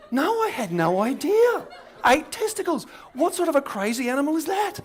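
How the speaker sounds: very high, loud mock amazement; hammed-up squeaky disbelief; astonished schoolboys. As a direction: shriek it as a flabbergasted schoolboy. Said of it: "all the boys in the back row going".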